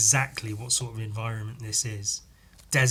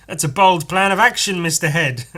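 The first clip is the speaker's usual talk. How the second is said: much higher, louder sidekick voice; eager sidekick; 6 semitones higher, 9 dB louder than usual. Acted. Sidekick praising Mister Head, high and eager. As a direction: cheer on the boss brightly.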